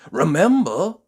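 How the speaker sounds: a hint of a kind old tramp; encouraging reminder; kindly tramp. Tramp reminding Jelly before leaving, kindly.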